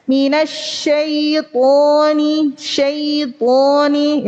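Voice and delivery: slow MINASYAITONI, ya glided without a bounce; correct recitation. Shown as a demonstration. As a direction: draw out SYAITONI with a smooth, unbounced "ai". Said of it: "lebih tepatnya begitu".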